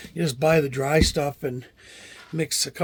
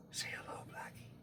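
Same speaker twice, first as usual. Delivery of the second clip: hushed whisper to a cat; whispered coaxing; 21 dB quieter than usual. Real, not acted.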